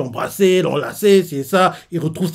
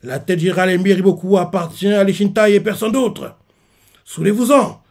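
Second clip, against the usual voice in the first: rousing war speech; 6 semitones higher, 4 dB louder than usual. Retold or imitated. Shin firing up his troops, shouting. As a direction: rouse the army to victory. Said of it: "reprend la parole et fait".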